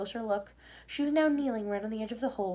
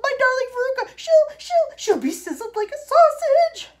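high, loud, hysterical wail; frantic mother screeching and spluttering; yelling; 12 dB louder than usual. A narrator's reading. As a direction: shriek in panic for your darling. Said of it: "yelled Missus Salt".

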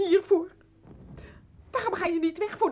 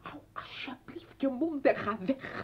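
tearful plea; 4 semitones lower, 7 dB quieter than usual. Acted. Grace smeekt huilend.

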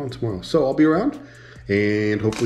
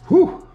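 a sharp high exhale of relief at the heat; burning-mouth whew; loud high whew; 8 dB louder than usual. Real, not acted. After eating hot wings.

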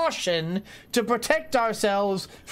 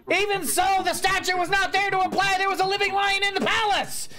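much higher, rapid-fire character voice; high-voiced arguer; 10 semitones higher than usual. Arguing over the lion statue in the palace, high-voiced and insistent.

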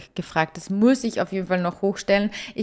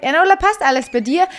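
higher, louder caring mother checking in; caring mom; 8 semitones higher, 6 dB louder than usual. Acted. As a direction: voice the mom asking her daughter, concerned. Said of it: "die Mom kommt jetzt auch wieder und sagt". (die Mutter, höher und lauter, besorgt fragend)

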